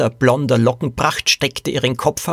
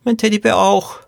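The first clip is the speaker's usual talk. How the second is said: put-on high, pleading child voice; insistent little girl; 9 semitones higher than usual. A narrator's reading. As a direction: insist like a scared small girl. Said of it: "beharrte das Mädchen". (hohe, beharrliche Kleinmädchenstimme)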